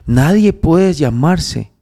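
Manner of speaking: high, loud pulpit voice, slow at the start; rising sermon fervor, voice pushed up high; fervent preaching. Acted. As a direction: preach it hard, pressing each claim. Preaching to Andrés.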